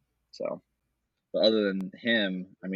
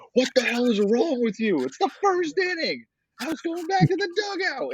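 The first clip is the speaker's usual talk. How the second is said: mock high-pitched outraged yell, much higher; squeaky outraged coach; 4 dB louder than usual. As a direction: play the coach as a shrill cartoon whine of outrage. Retold coach yelling at umpire.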